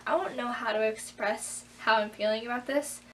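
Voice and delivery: ordinary delivery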